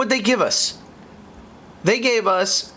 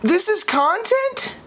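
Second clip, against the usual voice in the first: incredulous; slower than usual. Real, not acted. Mocking the update.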